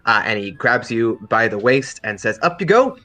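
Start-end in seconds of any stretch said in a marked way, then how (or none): 2.4-3.0 high, loud hoisting call; pitched-up, cheery lifting cry. Acted. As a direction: call out brightly as you lift someone. Character hoists someone up.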